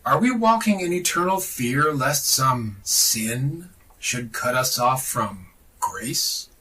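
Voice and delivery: ordinary delivery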